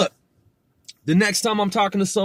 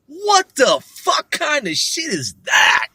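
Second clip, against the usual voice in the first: high, loud, incredulous cry; stunned disbelief; 9 semitones higher, 5 dB louder than usual. Real, not acted. Reacting stunned to the agency name.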